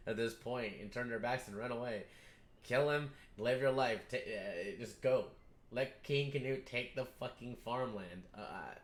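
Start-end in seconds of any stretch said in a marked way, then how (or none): none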